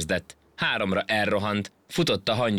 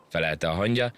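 plain reading voice

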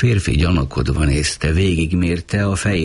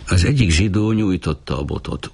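plain reading voice

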